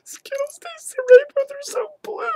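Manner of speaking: very high squeaky whimper; mock weeping. Vlogger lamenting blue Skittles, mock weeping. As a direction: squeal it through hammed-up tears. Continues tearful Skittles bit.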